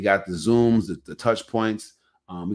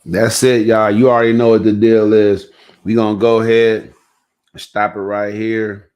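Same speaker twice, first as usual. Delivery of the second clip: loud, projected podcast wrap-up; host sign-off; 12 dB louder than usual. Real, not acted. Host closing the show for listeners, loud sign-off. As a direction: take over loudly to close out the show.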